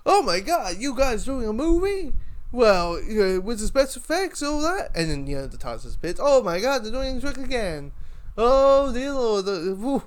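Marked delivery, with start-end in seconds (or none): 0.1-4.9 excited American tourist voice, pitched higher and louder; high, loud, starstruck American accent. Retold or imitated. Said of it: "he's American naturally". 6.2-10.0 excited American tourist voice, pitched higher and louder; high, loud, starstruck American accent. Retold or imitated. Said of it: "this Western guy".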